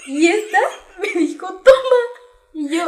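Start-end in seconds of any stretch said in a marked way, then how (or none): 1.5-2.0 voz de la hermana. Retold or imitated. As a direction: quote mimicked high and loud. Hermana ofrece agua con engaño. (imitando a la hermana, aguda y fuerte)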